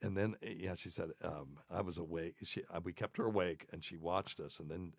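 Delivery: normal talking voice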